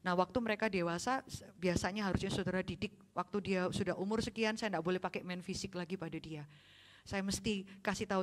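ordinary delivery

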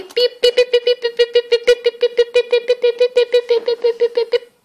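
high, loud, even machine beeps; rapid-fire beeps on one high note; imitated beeping. Setting the oven to twenty minutes, imitated beeping.

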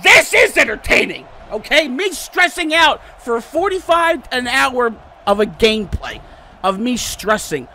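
loud, high insistence; heated rant. Real, not acted. Gamer defending his stressful video, heated rant. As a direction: argue back hard at the viewers. Ranting back at imagined viewers.

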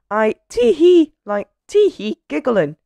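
very high, tittering character voice; giggling. A narrator's reading. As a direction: break the words up with tee-hee giggles. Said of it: "giggled Miss Giggles".

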